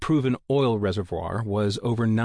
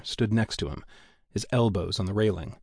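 plain reading voice

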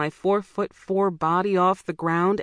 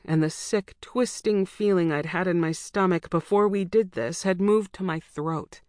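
ordinary delivery, nothing marked

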